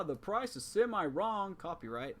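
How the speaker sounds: high, slow announcer voice, drawn out; game show host. Pretend. Parody game show title.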